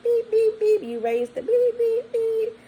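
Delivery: high censor beeps covering the swear words; bleeped-out cursing. Retold or imitated. Cursing out the woman's mom on the phone, high bleeps. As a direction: cuss them out, but bleep yourself.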